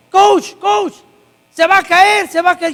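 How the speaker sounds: high, loud urgent warning cry; alarmed shout. Retold or imitated. Bystander shouts to the coach that a woman is falling, alarmed. People warn coach she'll fall.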